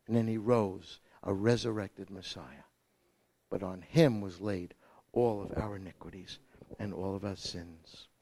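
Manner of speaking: quiet grave sermon tone; hushed sermon. Acted. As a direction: drop it down to a hush.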